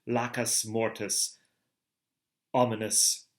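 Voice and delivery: plain reading voice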